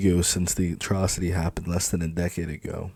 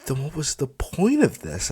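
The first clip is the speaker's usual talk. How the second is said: snapping; 9 semitones higher than usual. Acted. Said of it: "I snap at him".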